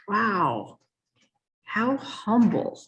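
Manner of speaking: slow, marvelling wow, drawn out; awed inner thought. Retold or imitated. Recalling how humble her boss was, slow and awed. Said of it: "And I'd think".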